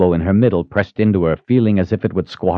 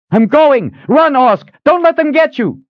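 high, loud warning cry; urgent shout; 5 dB louder than usual. A narrator's reading. Fleeing woman warning Orsk to run, urgent shout. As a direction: call back urgently over the battle.

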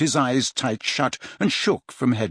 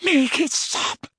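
very high, drawn-out moan; agonized, stretched-out plea; much slower than usual. A narrator's reading. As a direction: wail it slowly in torment. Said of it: "moaned Dumbledore".

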